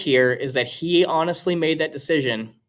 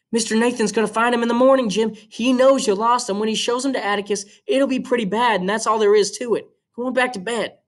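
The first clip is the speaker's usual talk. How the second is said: put-on higher young girl, protesting; Scout's voice, higher and urgent; girl's voice; 9 semitones higher than usual. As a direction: play the little sister pushing back. Scout reasons with Jim.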